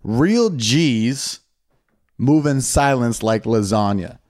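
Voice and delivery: slow, spaced lyric recital; recited lyric. Shown as a demonstration. Friend asks to hear the [name] line again, slow recital. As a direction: savor each word of the line. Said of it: "Say this one more time for the fans".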